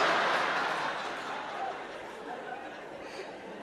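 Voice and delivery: high breathy cackle of laughter; high burst of laughter. Real, not acted. Big laugh after the chant.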